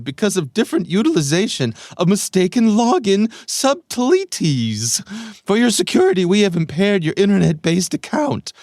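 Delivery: high, loud, over-the-top cartoonish reading; crazy voice. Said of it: "do a crazy voice here".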